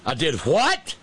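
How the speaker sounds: high, incredulous rise on what; mock-horrified double take, pitch shooting up; shocked disbelief. King answering the oracle's revelation, acted shock.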